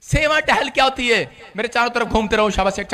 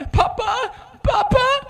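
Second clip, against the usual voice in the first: high mock-whimpering papa; whiny child call; 8 semitones higher, 6 dB quieter, slower than usual. A sad believer whining to God, in a high childish voice.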